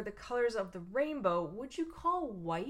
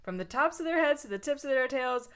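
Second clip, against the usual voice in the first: sassy read; 4 semitones higher, 6 dB louder than usual. Retold or imitated. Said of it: "the book sasses you".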